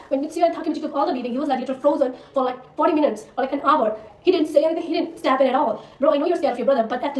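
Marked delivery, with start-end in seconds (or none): none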